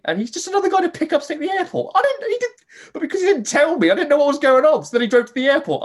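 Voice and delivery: telling it through real high laughter; can barely get the words out for laughing. Real, not acted. Friend recounting the taxi ride, laughing hard.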